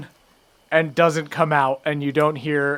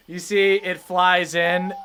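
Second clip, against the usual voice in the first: ordinary talk, nothing marked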